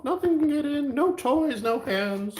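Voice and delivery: gleeful little squeak; squeaky silly voice. Pretend. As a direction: keep the tiny cartoon voice going.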